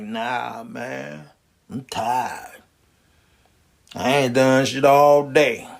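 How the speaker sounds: lazy exhausted mumble; tired limp Larry. Acted. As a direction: drag it out like you've got nothing left. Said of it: "He like".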